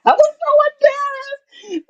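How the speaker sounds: high-pitched shriek; embarrassed shriek. Real, not acted. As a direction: squeal it out in embarrassment. Embarrassed recalling the nurse.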